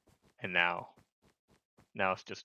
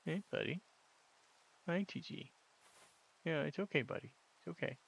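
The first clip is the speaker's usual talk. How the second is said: cooing to a scared pet; 7 semitones higher, 7 dB quieter than usual. Real, not acted. Soothing a pet.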